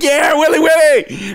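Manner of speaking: high, drawn-out mock cheer; root for him like a delighted kid; imitated crowd chant; encouraging cheer. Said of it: "and we're encouraging".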